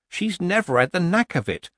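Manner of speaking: mild, high-pitched weak defence; weak excuse. A narrator's reading. Nanny excusing her friend's cooking, weakly. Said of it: "said Nanny weakly".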